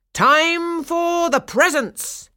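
slow, high, loud proclamation; announcing. A narrator's reading. Councillor to the children at the party, announcing. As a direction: draw it out loudly for the whole room. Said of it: "Councillor Stahlbaum announced".

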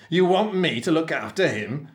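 spluttering captain. A narrator's reading. Captain objects to minding the cabin boy, spluttering. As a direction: push it up into an indignant question. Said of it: "spluttered the captain".

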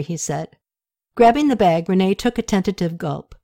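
ordinary delivery

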